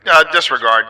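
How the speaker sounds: loud household announcement; announcement call. Real, not acted. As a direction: call everyone to attention.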